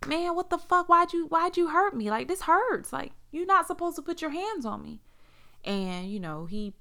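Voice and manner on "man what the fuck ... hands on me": higher pitched, hurt and pleading; crying out that it hurts, voice pitched up; telling the boyfriend he can't put hands on her, tearful; tearful protest. Retold or imitated. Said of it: "tears was running down my face".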